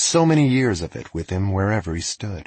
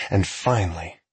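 ordinary delivery

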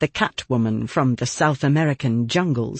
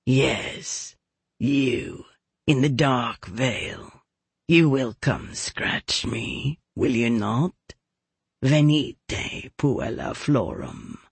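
lower, softer, slow catlike growl; 3 semitones lower, 4 dB quieter, much slower than usual. Acted. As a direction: purr it low and slow, like a cat. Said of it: "in a low voice that sounded like a growl".

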